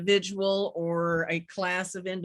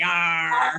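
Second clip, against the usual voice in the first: loud pirate growl; pirate arr; 3 semitones lower, 5 dB louder than usual. Pretend. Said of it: "It's also Talk Like a Pirate Day".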